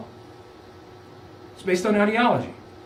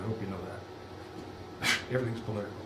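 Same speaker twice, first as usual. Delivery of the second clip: hushed aside; 10 semitones lower, 15 dB quieter than usual. Real, not acted. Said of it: "aside to students".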